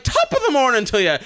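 high, quick, lilting Irish greeting; chirpy stage Irish. Shown as a demonstration. Showing how she doesn't say it, chirpy stage Irish. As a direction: toss it off like a played-up Irish cliché. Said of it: "she doesn't say it like".

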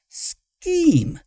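high, drawn-out hissed correction. A narrator's reading. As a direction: hiss the word SCHEME urgently. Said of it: "Moggitz hissed".

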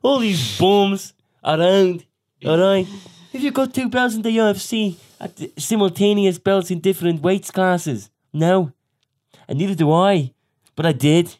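bad Irish accent bellowed as a cocky fighter. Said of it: "That's a really bad accent".

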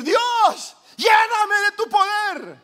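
grito agudo y lento al cielo; clamor desesperado. Retold or imitated. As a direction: clama a Dios, mucho más alto y fuerte. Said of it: "comenzó a clamar". (clamor a gritos, agudo y lento)

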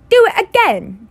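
high, loud spoilt demand; a spoilt boy bossing his father; petulant command; bratty order. A narrator's reading. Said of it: "Dudley ordered".

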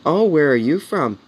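high, swooping mock-girl voice; imitating smitten girls. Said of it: "the girls would say to him".

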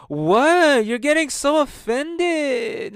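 high, drawn-out mocking whine; sister impression. Brother mimicking sister dodging his criticism, mocking impression. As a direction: stretch it out high in a mock sister voice. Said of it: "that's exactly how she sounds".

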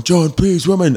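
high, mild mock crowd call; imitated crowd shout. Crowd calling for the next DJ, mild imitated shout. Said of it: "they were all shouting for John Please Woman".

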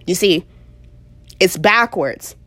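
ordinary talk

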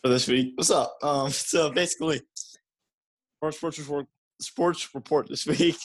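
talking while chewing gum. Real, not acted. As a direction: speak around a wad of gum. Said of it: "Sorry, I have gum in my mouth".

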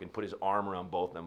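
ordinary talk

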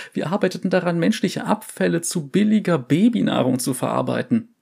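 high, frightened survivor voice; traumatized scientist. Acted. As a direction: recount the horror, shaken. Second survivor's shaky testimony.

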